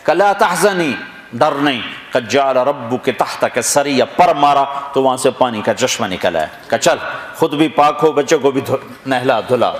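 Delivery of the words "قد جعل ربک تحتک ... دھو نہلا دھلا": usual speaking voice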